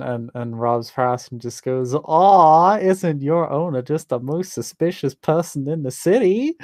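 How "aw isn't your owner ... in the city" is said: loud cooing tease to a dog; teasing baby talk. Acted. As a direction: tease sweetly, with a sly dig.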